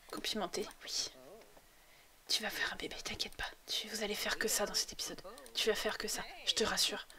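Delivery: low, very soft, half-whispered; hushed coaxing. Pretend. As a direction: coax them in a near whisper. Coaxing the Sim in an undertone.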